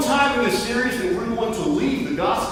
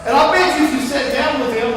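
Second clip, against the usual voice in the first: ordinary talk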